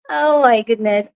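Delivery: normal talking voice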